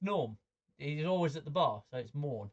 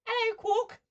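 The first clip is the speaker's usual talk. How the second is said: squeaky, much higher and louder greeting; high-pitched voice; 9 dB louder, slower than usual. Pretend. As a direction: chirp it up high like a tiny alien barfly. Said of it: "he has a really high-pitched voice".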